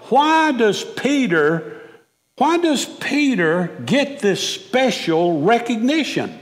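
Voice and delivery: usual speaking voice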